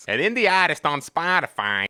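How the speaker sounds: put-on eastern accent, R dropped in "artist"; playful eastern accent with a flattened "artist"; farther-east accent. Shown as a demonstration. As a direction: let the accent drift east on "indie artist". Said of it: "I'm moving farther east".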